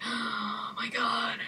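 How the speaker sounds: breathless, hushed whisper; awed whisper. Retold or imitated. Gamer reliving a tense game moment, whispering in awe. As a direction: breathe it out in shock.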